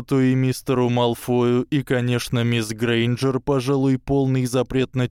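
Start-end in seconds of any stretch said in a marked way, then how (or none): none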